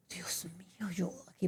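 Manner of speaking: breathless whisper of despair; hearing an accent she can't follow, whispered panic; whispered dismay. Retold or imitated. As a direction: whisper it like the hope just died.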